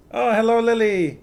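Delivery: high, loud delighted hello; excited greeting. Real, not acted. Streamer greeting viewer Lily with a high, loud, delighted hello. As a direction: burst out happy to see her.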